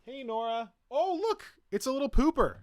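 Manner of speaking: very high, delighted pet talk; cooing to a pet. Real, not acted. Greeting the new puppy coming in, high cooing. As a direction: squeal fondly at a little animal.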